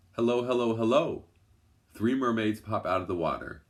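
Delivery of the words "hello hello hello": high croaky frog greeting; croaking frog. A narrator's reading. A frog on the log croaks encouragement to Lou, croaky and high. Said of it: "croaking encouragement".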